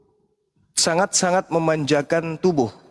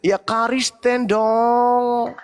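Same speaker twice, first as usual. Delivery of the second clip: smug; 6 semitones higher, much slower than usual. Acted. Mocking answer to own question.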